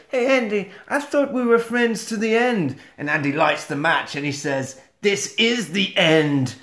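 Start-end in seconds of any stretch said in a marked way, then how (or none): 0.1-2.8 high put-on doll voice; Chucky impression. Chucky to Andy holding the matches, high doll voice. 5.0-6.5 slow, deliberate mock-dramatic delivery; drawn-out payoff line. Andy to Chucky as he lights the match, slow and deliberate. Acting Andy's final line.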